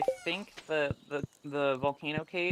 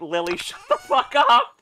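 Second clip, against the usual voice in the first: mock yell; 5 semitones higher, 8 dB louder than usual. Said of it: "just go like".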